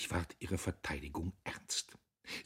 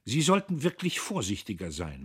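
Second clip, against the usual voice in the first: louder, higher warning; frowning reproof; 6 semitones higher, 6 dB louder than usual. A narrator's reading. Man warns the girl to be careful, frowning reproof. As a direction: admonish with a frown. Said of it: "meinte er stirnrunzelnd".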